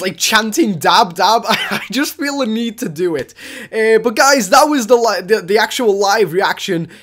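normal talking voice

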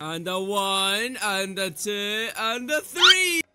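drawn-out count rising to a loud three; slow count-in. Pretend. Gamer spinning someone, count building slowly to a shout. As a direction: build up to the big throw.

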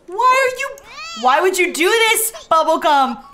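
high, loud wail of protest; dismayed shouting, starting very high and easing down; exasperated yell. Real, not acted. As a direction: plead loudly with a misbehaving character. Scolding game character as chaos erupts.

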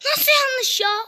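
high-pitched indignant protest; exasperated, incredulous outburst; indignant disbelief. Real, not acted. Code rejected despite being right.

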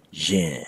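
"yin" said slow and careful, low and soft; Burmese pronunciation. Shown as a demonstration. Teacher models "yin" for learners, clear and careful. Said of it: "Let me pronounce it for you again".

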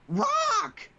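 high, loud falsetto yell; shouting female voice. Acted. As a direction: squeal it like a woman. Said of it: "you read the female".

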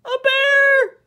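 high, loud scream of fright. A narrator's reading. Eddie comes face to face with bear, screaming. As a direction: shriek it out in alarm. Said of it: "screamed Eddie".

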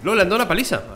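excited. Real, not acted. Laughing at a beaten character.

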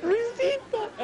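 a friend's scared answer to the shouting dad; scared little kid. Acted. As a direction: squeak the name out like a terrified child. Scared kid's tiny answer.

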